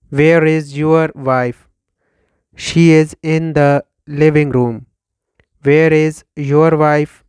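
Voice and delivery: ordinary delivery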